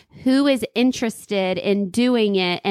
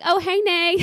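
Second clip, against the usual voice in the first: high bright call; cheerful greeting; 8 semitones higher, slower than usual. Retold or imitated. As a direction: greet her sweetly, caught out. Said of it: "I was like".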